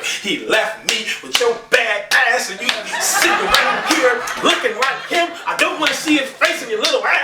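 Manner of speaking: high mock mama rant. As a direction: rant at the kid for looking like his dad. Mama raging at kid.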